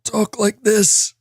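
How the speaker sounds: put-on high, slow, creaky old man voice. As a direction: drag it out like a frail old man. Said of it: "Do your old man voice".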